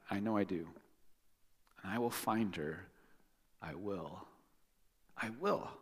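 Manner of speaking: soft, hushed little vow to himself; quiet determination. Acted. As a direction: say it softly, almost to yourself. Baby bird resolving quietly.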